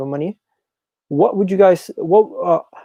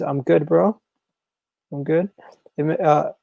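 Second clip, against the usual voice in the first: ordinary talk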